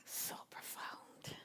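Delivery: a breathless, slow whisper of awe; awed whisper. Real, not acted. Listener moved by the share, whispering in awe.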